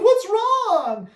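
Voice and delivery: hammed-up fretting pet parent; mock worried owner. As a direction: gush over the puppy like a doting owner. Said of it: "be like".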